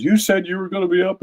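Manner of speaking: high-pitched imitation of her tearful reproach; retold complaint, voice lifted high; upset, crying complaint. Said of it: "What are you crying about?".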